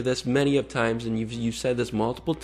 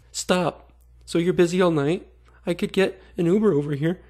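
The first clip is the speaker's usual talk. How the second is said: high, louder mocking read; mock predator voice; 7 semitones higher, 4 dB louder than usual. Vlogger reading the predator's pleading texts, mocking high voice.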